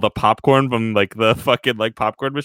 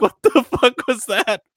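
stunned disbelief; 12 semitones higher than usual. Acted. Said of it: "It's like".

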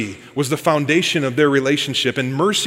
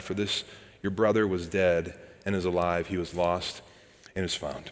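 soft, fading Bible reading; quiet scripture reading; 6 semitones lower, 7 dB quieter than usual. Acted. Preacher reading the older son's complaint, growing softer. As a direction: let the passage fall quiet.

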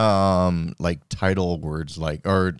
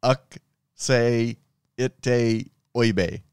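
halting, puzzled attempt at dirty talk; confused dirty talk; 3 semitones higher, much slower than usual. Asked to talk dirty in Pig Latin, confused and halting. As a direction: piece it out haltingly, a fragment at a time. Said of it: "the tone and confusion that".

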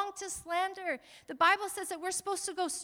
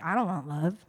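a hint of a sulky refusal, voice dropped lower; mock contrarian; 10 semitones lower than usual. Pastor mocking a congregant who claims not to want love, lightly voiced. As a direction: play the odd one out who turns love down. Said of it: "Ain't anybody like".

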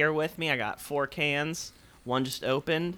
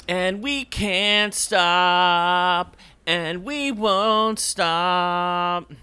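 dramatic slogan; 4 semitones higher, 5 dB louder, much slower than usual. Pretend.

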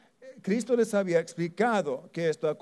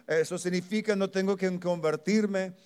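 ordinary talk

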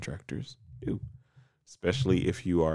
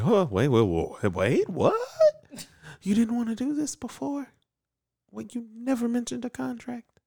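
flustered Amelia. Retold or imitated. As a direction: sputter in rising panic up high.

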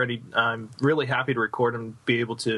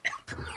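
coughing; 10 dB quieter than usual. Real, not acted. Guest choking on water.

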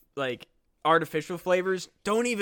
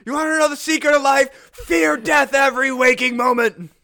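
put-on maniac old man shout; 7 semitones higher, 9 dB louder than usual. Old man tells the secret of life, loud and high. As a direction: preach it like a wild-eyed lunatic.

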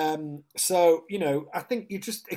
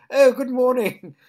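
high, loud, slow mock-posh RP greeting; put-on posh accent; 12 semitones higher, 15 dB louder, slower than usual. Friend greeting the London shopkeeper in a put-on posh RP accent. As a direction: plum in the mouth, trying too hard. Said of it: "tried it on with this".

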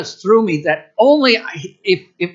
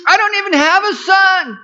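high, loud hammed-up Italian accent; over-the-top Italian accent voicing Abraham; mock Italian accent; 4 dB louder than usual. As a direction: whine it like a comic Italian. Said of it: "That's Italian, isn't it?".

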